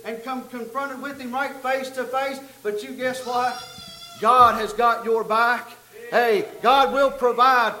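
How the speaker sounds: fervent sermon delivery; full-force preaching. Acted. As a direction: lift the room with the miracle story.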